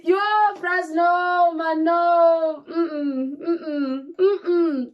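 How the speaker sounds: high, loud frantic no's; refusing in a panic, voice crying; panicked protest. Retold or imitated. Retelling her panic to viewers, loud and tearful. Reacting to baby pulled out.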